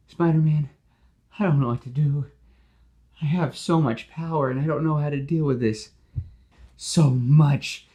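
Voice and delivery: high, loud, desperate plea; overwhelmed Max. Acted. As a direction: beg for help, losing control.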